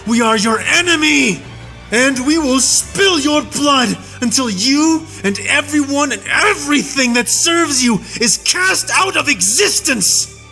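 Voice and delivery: high, fierce war-cry defiance; a human soldier vowing revenge on his captor; vengeful fury. A narrator's reading. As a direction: declare the hunt with burning hatred.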